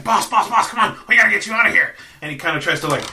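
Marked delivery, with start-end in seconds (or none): 0.0-2.0 high, louder pleading goblin; urgent goblin. Acted. Goblin crony pleading with Krenko to flee, high and urgent. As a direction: hurry the boss like a panicky minion. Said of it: "is just saying".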